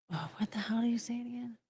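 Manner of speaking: unvoiced whispered aside to self. Real, not acted. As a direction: whisper it under your breath. Aside while searching for a word.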